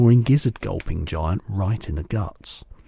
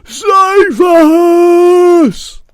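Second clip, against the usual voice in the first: very high, loud, drawn-out scream; terrified scream; 14 dB louder than usual. A narrator's reading. Giant screams in his nightmare, high and loud. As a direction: scream for your life. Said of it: "screamed the flesh lump eater".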